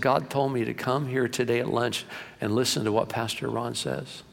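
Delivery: usual speaking voice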